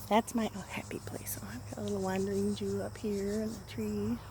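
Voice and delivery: soft unvoiced whisper. Real, not acted. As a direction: murmur it privately to the cat. Whispered asides to cat.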